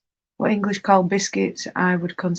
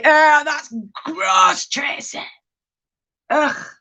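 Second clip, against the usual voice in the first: disgusted; 8 semitones higher, slower than usual. Real, not acted. Streamer recoiling at talk of pumpkin pie, disgusted. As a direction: exclaim in disgust, high and stretched out.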